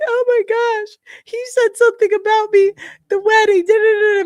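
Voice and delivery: high mock gushing voice; mocking her. Retelling the accuser's story, mocking her in a high gushing voice. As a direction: squeal it like a dramatic girl telling on him. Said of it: "And she's like".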